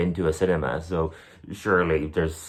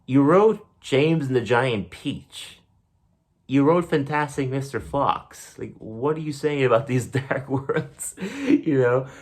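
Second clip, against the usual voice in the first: higher, slow, disbelieving; as if asking the author how dare he; incredulous thought; 6 semitones higher, slower than usual. Retold or imitated. Reader challenging Roald Dahl about dark worlds, incredulous. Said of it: "I'm thinking to myself".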